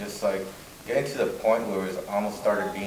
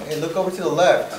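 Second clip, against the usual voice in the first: higher-pitched re-enacted heads-up; acted call-out; 6 semitones higher, 4 dB louder than usual. Crewmate at the periscope telling him to look, acted call-out.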